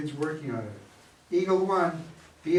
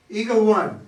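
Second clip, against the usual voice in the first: calling out; 6 semitones higher, 11 dB louder than usual. Acted. Mission control calling the astronaut.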